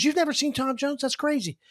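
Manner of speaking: retold disbelief. Friend amazed he's never seen [name], disbelieving. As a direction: imitate a friend who finds it crazy.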